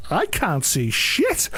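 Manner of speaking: slow quoted exasperation. Retold or imitated. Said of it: "so I was like".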